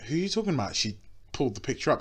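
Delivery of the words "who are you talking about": playing dumb. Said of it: "And I went".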